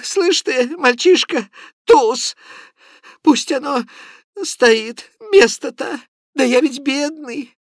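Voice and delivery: put-on high, loud voice of a feverish old clerk; a sick, muddled man insisting on his point; high-pitched, agitated rambling; Prokharchin's voice. A narrator's reading.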